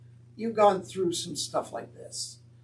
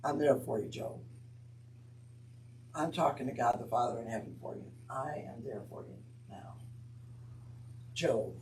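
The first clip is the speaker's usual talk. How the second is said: a lower, softer voice of Jesus comforting Job; Jesus speaking tenderly; 8 semitones lower, 9 dB quieter than usual. Retold or imitated. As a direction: reassure him gently, as Jesus would. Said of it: "this is Jesus saying".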